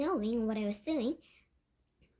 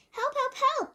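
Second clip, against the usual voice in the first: very high, loud cries for help; screaming; 12 semitones higher, 5 dB louder, slower than usual. A narrator's reading. Cook with mouse in his trousers, screaming. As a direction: squeal for rescue in alarm. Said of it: "the man was screaming".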